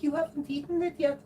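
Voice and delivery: high neighbor lady voice. Retold or imitated. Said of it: "She's like".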